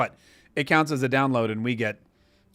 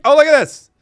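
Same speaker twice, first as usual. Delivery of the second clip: loud, high burst of excitement; excited discovery; 11 semitones higher, 12 dB louder than usual. Real, not acted. Host spots a super chat arriving, excited outburst. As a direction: exclaim it loudly, voice leaping up.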